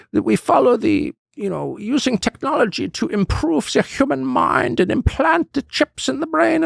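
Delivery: high, put-on German accent, pompous and preachy; Klaus Schwab German accent. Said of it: "in his accent".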